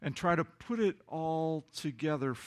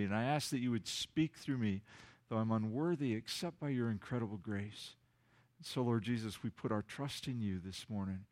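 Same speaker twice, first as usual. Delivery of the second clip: pastoral prayer; 4 semitones lower than usual. Acted. Leads congregation in prayer.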